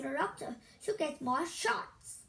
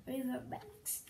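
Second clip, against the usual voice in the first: the punchline repeated much softer; 9 dB quieter than usual. Real, not acted. Child reading jokes, softly repeating the punchline. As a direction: say it again under your breath, to yourself. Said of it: "In river banks".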